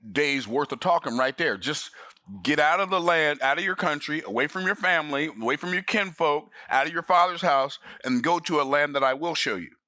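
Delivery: ordinary talk, nothing marked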